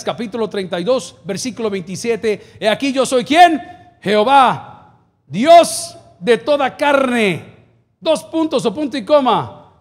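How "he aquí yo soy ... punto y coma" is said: loud, slow, drawn-out verse reading; proclaimed scripture. Real, not acted. Pastor reading Jeremías to the church, loud proclaimed scripture. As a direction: declaim the verse to the congregation.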